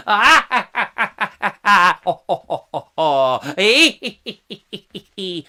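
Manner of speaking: loud staged ha-ha, ho-ho. Acted. Singer recalling Laughing Policeman, loud mechanical song laughter. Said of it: "that's the laughing police".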